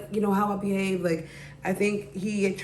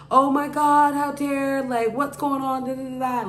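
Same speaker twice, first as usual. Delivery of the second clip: high-pitched, louder mock outrage at first; imitated outrage; 6 semitones higher, 3 dB louder than usual. Friends react to her date story, mock outraged. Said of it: "they were just going off".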